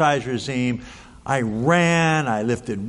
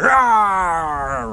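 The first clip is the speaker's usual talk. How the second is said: a hammed-up growling roar, a touch higher; mock roar; 5 semitones higher, 3 dB louder than usual. [name] charging the steer with a mock roar. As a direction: roar like a charging man to scare off a beast. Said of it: "yelling at it".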